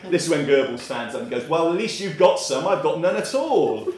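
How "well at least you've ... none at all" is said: loud, high comic outburst; hammed-up, raised-voice complaint; mock indignation. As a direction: protest loudly as the joke's punchline. Goebbels complains he has no meatballs.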